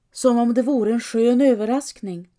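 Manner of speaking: plain reading voice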